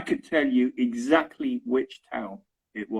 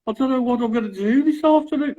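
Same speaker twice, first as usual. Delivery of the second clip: high-pitched mock Birmingham whine; complaining Birmingham accent; 13 semitones higher, 5 dB louder than usual. Shown as a demonstration. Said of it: "They always sound like they're complaining".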